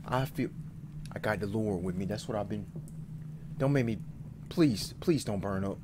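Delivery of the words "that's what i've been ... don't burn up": ordinary talk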